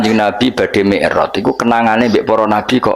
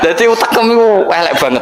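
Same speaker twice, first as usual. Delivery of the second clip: laughing; 9 semitones higher, faster than usual. Real, not acted. Laughs then quips fast.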